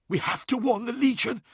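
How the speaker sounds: high, tense hiss; high, hissed alarm; urgent hiss. A narrator's reading. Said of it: "hissed Uthizar".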